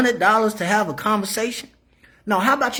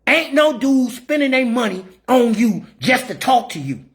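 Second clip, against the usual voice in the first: ordinary delivery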